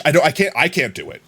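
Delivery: ordinary talk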